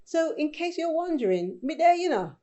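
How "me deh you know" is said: Jamaican accent, a quick phrase; Jamaican patois. Shown as a demonstration. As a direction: say it fast like one word. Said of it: "you may be laughing about how I'm saying it".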